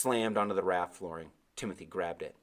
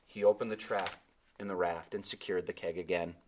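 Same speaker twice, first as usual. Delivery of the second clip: ordinary delivery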